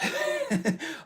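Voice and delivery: very high-pitched giggle; real squeaky laugh. Real, not acted. Laughs at turtle lifespan.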